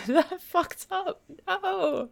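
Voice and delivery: giggling through the admission; laughing at a slip. Real, not acted. Laughing at her fumbled twister.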